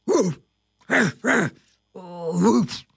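loud imitated woofs; gruffly punched woofs; dog barking. A narrator's reading. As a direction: bark like a dog outside the burrow.

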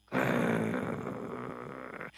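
low rumbling dog growl. A narrator's reading. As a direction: growl deep like a big dog. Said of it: "says the firehouse dog".

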